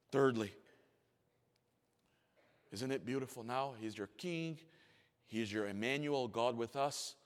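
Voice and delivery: usual speaking voice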